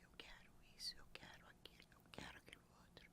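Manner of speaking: barely audible mumbling whisper; mumbled whisper. Retold or imitated. Imitating shy people mumbling their wishes at a ritual. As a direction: mumble the wishes under your breath, ashamed. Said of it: "ficava balbuciando".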